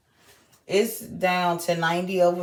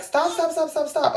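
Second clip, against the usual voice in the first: high, rapid-fire mock alarm; urgent retold stop; 9 semitones higher than usual. Nurse tells labouring mother to stop pushing, mock urgent. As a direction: imitate a nurse urgently halting someone, pitched high.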